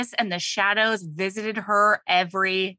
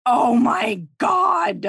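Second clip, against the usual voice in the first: slow, loud, high oh my God; overwhelmed; 7 semitones higher, 4 dB louder, much slower than usual. Real, not acted. Moved listener overwhelmed after the love scene, drawn out loud cry. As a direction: let it burst out slowly. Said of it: "overwhelmed by the story".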